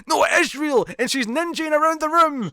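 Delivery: high, loud burst of excitement; excited. Retold or imitated. Podcaster recalling a TV twist, high excited yell.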